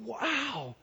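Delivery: soft, drawn-out awe; hushed wonder. Retold or imitated. Preacher voices our response to God's world, hushed wonder. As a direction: breathe it out in wonder.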